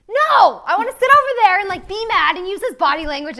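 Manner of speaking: high, loud, fast sulky protest; bratty refusal. Retold or imitated. Wife refusing to cuddle husband mid-fight, high bratty protest. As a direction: whine like a pouty kid. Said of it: "And I'm like".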